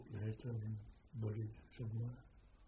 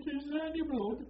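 high, loud panicky question, retold; a wounded lad begging to know if he's bleeding; frightened, near crying; 14 semitones higher, 5 dB louder than usual. Wounded soldier asks his sergeant in panic, high and near tears. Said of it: "he was more or less crying".

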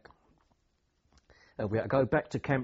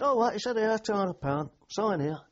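high, louder, startled then brisk; surprised paymaster; 9 semitones higher, 4 dB louder than usual. Retold or imitated. Paymaster startled by the two hundred pound request, high surprise. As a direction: act caught off guard, then just sign it off.

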